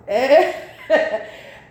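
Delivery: a big laugh at her own story about the ex; loud laugh. Real, not acted. Loud laugh. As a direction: burst out laughing, high and loud.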